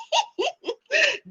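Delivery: high, quiet giggling; real giggle. Real, not acted. Laughing after greeting the brother, high giggle. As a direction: giggle helplessly at your own joke.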